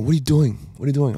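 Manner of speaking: high, loud retold outburst; yelling at mum in frustration; retold upset yell. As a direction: son yells at mum for still working through sore hands, upset and loud. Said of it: "I'm like, super upset".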